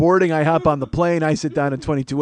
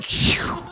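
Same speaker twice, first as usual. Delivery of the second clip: relieved breathy sigh; 3 dB quieter than usual. Retold or imitated. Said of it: "And I'm like".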